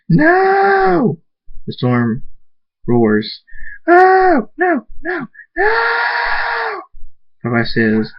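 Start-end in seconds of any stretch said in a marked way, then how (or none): 0.1-1.2 very high, drawn-out scream. A narrator's reading. Thunderstorm jumps up at the bad news, screaming. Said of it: "jumps up and screams". 3.9-6.8 very high screamed wails; anguished screaming. A narrator's reading. Thunderstorm in so much pain, screaming in anguish.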